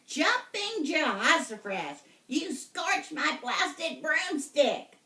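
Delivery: high, loud, slow witch voice; angry witch. Acted. Witch angry over her scorched broom, high and loud. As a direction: rant like a furious witch. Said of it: "stomping around and shaking her broom".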